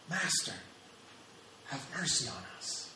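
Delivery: softly savoured quotation; quiet repeat. Retold or imitated. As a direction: repeat the plea softly, for yourself. Repeats the phrase reflectively.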